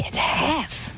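breathy whisper of disbelief; a hushed little aside. Acted. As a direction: whisper it like a secret. Aside on the cut debt.

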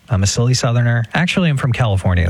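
ordinary talk, nothing marked

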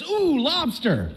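high delighted wife, distracted by lobster; wife's delighted ooh. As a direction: swoon over the lobster, not the ring. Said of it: "and she says".

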